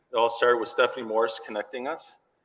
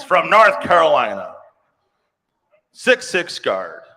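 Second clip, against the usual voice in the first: arena announcer; 4 semitones higher, 7 dB louder than usual. Acted. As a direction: announce her like a starting lineup. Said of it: "it sounds like the Bulls' introductions".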